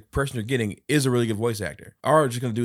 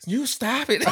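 high laughing you stop it; laughing high retort; slower than usual. Retold or imitated. Uncle imitating the niece's retort, high and giggling. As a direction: toss it back, giggling. Child quote.

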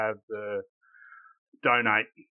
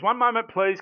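catchphrase, playful; 9 semitones higher, 3 dB louder than usual. Shown as a demonstration. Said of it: "I love this".

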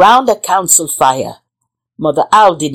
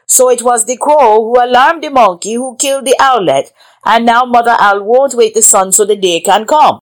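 king's voice; 4 semitones higher, 5 dB louder than usual. Acted. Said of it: "The king said to the council".

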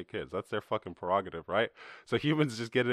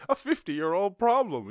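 much higher, louder scornful parody; sneering mock echo, pitched up; 9 semitones higher, 5 dB louder than usual. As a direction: parrot it back as a silly voice. Sarcastic repeat of humans' claim.

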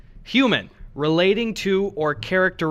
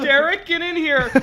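high excited call; 11 semitones higher than usual. Pretend. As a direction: shout for a friend. Calling out excitedly.

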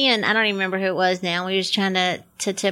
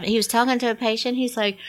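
ordinary talk

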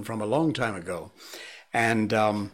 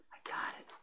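whispering; 17 dB quieter than usual. Real, not acted. Whispering to the dog.

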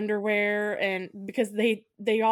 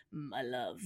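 lower, softer lover's reply; mock romantic; 8 semitones lower, 11 dB quieter than usual. Husband answers wife's call, low soft mock romance. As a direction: breathe it like a romance hero. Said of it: "he rips something off his head and says".

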